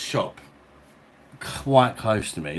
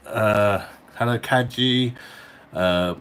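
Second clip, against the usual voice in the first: hearty greeting; 3 semitones higher, 6 dB louder, slower than usual. Real, not acted. Greets viewer in mock French.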